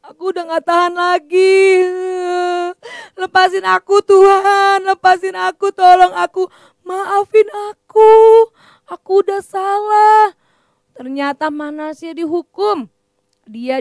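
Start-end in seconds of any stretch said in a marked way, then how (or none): 0.0-10.3 acted weeping plea. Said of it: "Manasye tiba-tiba menangis".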